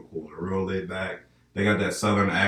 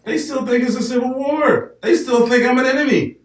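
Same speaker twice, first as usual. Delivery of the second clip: mock southern hostility; 7 dB louder than usual.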